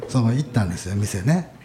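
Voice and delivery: ordinary talk, nothing marked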